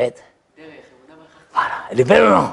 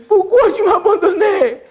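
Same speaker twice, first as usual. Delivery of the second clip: high, pleading cry of abandonment; high pleading lament; 12 semitones higher, 3 dB louder than usual. Retold or imitated. Translating David's cry.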